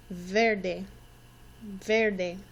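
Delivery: "Verde" said slowly, the E sounding like an A, "vair-day"; Spanish model word. Shown as a demonstration. Teacher modelling "Verde" for her class to repeat. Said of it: "Can you say that with me?".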